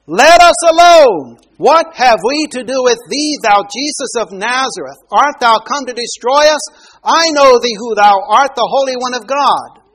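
a defiant demon roaring at Jesus; demon shouting. Acted. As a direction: bellow it out like a possessed man in the synagogue. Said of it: "He shouted it out, by the way. That's what I'm trying to give the idea".

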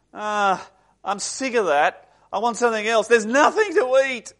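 put-on complaining teenager. Teenager rejects mum's baking, mock whining protest. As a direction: build from grumble to high, loud whine. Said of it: "And their response is".

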